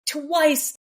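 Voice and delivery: "twice" pitched high and stretched; emphatic "twice". Shown as a demonstration. Repeating it for the listeners, emphasis on "twice". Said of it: "Emphasis on the twice".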